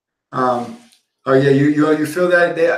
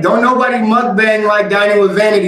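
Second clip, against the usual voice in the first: real excited cheerleading, pitched up; hyped-up praise; 6 semitones higher, 4 dB louder than usual. Real, not acted. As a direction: hype her up like her biggest fan. Hyping up the video's creator.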